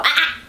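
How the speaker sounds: short loud "Ah!" to stop the dog; quick scolding "Ah!" to a dog; sharp correcting yelp. Shown as a demonstration. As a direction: bark out a quick "Ah!". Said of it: "I just do that".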